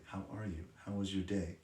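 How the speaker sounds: very soft, low, tender question. Shown as a demonstration. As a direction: ask softly, as if to a spouse. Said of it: "Lower your voice. Speak slowly".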